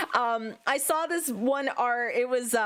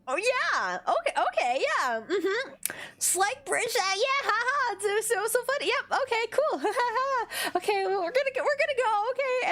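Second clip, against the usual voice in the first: high, strained agreeable chatter; imitated awkward politeness; 9 semitones higher than usual. Shoppers humouring the clerk before escaping, imitated awkward politeness. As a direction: humour them while holding back laughter.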